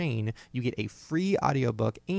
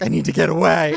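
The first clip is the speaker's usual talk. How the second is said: loud, laughing cry for escape; desperate mock plea; 4 semitones higher, 14 dB louder than usual. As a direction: wail it like trapped kids. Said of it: "kids are like".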